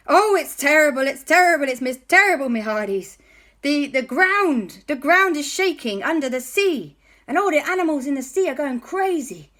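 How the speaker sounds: high, loud frantic pirate; frantic pirate reporting trouble; panicked pirate voice. Acted. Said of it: "And he said".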